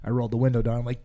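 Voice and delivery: ordinary delivery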